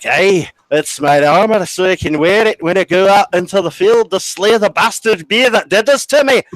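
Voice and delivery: high, loud hammed-up vow of vengeance; rally like a pretend knight; mock battle cry. Patient jokes to prosthetist about his leg armor, mock-heroic outcry. Joking vow of revenge on bee.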